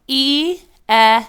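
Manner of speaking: E punched out loud and high; teacher drilling the sound. Shown as a demonstration. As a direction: call out each E sharply. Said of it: "Say it with me".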